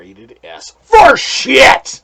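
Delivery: loud, drawn-out triumphant shout; emphatic shouted verdict. Real, not acted. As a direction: bellow the rating slowly, each word stretched. Delivers his verdict as climax.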